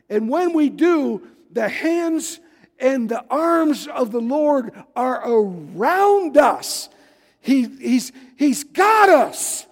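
loud, high, slow preacher's shout; full force preaching. Acted. Preacher rousing his congregation, full-force preaching shout. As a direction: drive it home to the congregation.

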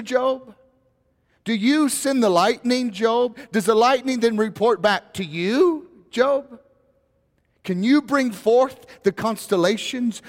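a God piling up questions on Job, rising; voicing God. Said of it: "he starts off like this with Job".

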